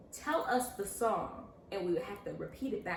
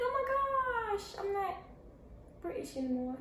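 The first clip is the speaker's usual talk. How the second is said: excited realization; 11 semitones higher than usual. Retold or imitated. Student discovering the accent is gone, excited realization. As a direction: stretch it high, then ease down. Retold delight at losing stuck accent.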